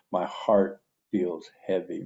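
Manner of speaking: ordinary delivery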